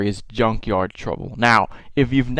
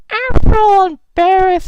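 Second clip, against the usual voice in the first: imitating Yoshi; 6 dB louder, slower than usual. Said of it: "all of a sudden he goes".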